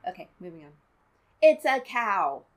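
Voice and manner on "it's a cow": exasperated outburst. Real, not acted. Exasperated reveal.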